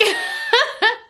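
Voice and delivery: high giggle at her own failed burp; real laugh. Real, not acted. Telling viewers about a burp, then a high giggle.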